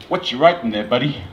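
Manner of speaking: badly faked American accent; terrible American accent. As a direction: put on a clumsy American voice. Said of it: "That's a terrible American accent".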